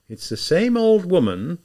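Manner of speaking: usual speaking voice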